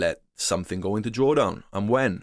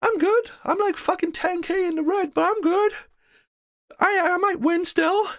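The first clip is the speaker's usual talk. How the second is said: mocking deluded trader. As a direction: play the trader in denial, squeaky and breezy. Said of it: "They're like".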